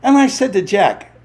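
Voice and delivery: ordinary talk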